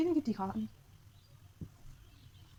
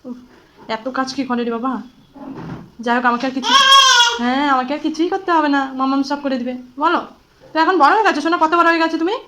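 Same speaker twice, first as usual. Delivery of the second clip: playful excited mock-scolding; 3 semitones higher, 14 dB louder than usual. Real, not acted. Mother playfully scolds dancing toddler.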